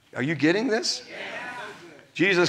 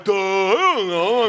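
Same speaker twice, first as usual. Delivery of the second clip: dumb reply; slower than usual. Retold or imitated. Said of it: "they're all like".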